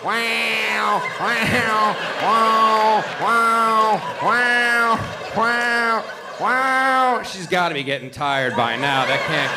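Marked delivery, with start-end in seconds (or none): 0.0-0.0 cat yowling. Retold or imitated. 0.0-7.2 cat wails climbing higher and louder; yowling cat building to shout. Retold or imitated.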